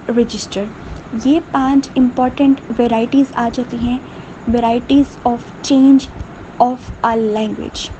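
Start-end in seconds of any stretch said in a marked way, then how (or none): 4.5-7.9 very slow, word by word, for note-taking; teacher dictating at a crawl; slow dictation. Shown as a demonstration. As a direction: spell it out slowly so students can write. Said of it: "teacher dictating notes slowly".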